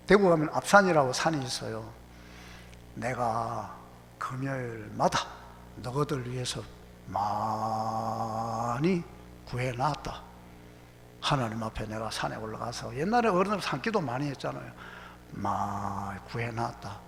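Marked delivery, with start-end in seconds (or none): 2.9-12.9 put-on low, soft, slow old father; father's calm reply. Voices the father's calm answer. 15.3-17.0 low, hushed, drawn-out father's voice; father's calm reply. Retold or imitated.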